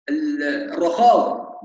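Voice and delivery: usual speaking voice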